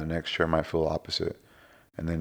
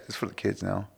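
normal talking voice

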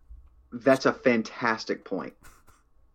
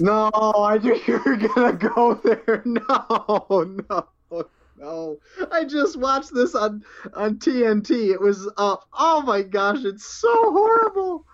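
laughing protest; 10 semitones higher, slower than usual. Real, not acted. Groaning at Clash of the Titans pick.

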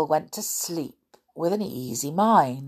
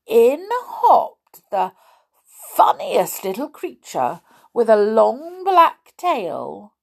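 plain reading voice

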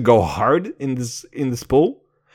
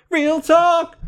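sudden high yelp of real talk; excited shout; 6 dB louder than usual. Real, not acted. Hyped podcaster yelling his catchphrase, excited. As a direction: blurt it out, thrilled.

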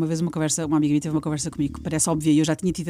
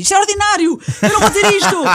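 high, loud indignant protest; mock-outraged refusal, pitched up; indignant outburst; 12 semitones higher, 8 dB louder than usual. [name] refusing a crude radio line, indignant and loud. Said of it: "que eu disse".

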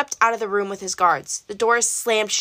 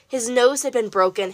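ordinary delivery, nothing marked

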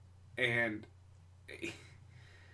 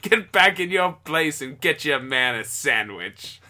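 put-on loud, higher, slow old-time voice; forties chauvinist; 4 semitones higher, 9 dB louder, slower than usual. Misogynist putting the woman in her place in forties voice. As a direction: scold her like a vintage sexist. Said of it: "And he's like".

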